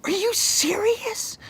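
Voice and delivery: slow, soft whisper. Real, not acted. Owner whispers to her dog, slow mock disbelief.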